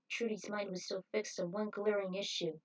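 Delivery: usual speaking voice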